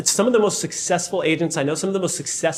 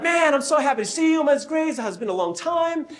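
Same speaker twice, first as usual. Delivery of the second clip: excited fan voice; 9 semitones higher than usual. Retold or imitated. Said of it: "he's like".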